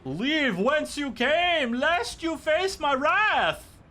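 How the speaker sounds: high, slow booming warning; hammed-up mystical threat, drawn out; pompous sorcerer.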